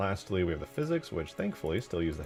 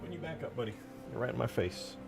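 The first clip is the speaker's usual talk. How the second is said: soft pet talk; 4 semitones higher, 9 dB quieter, faster than usual. Real, not acted. Pet in the speaker's face.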